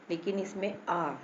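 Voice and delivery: ordinary talk, nothing marked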